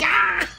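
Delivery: short, high mock yell; mock scream. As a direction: imitate a sudden cry. Imitates Ben's cry.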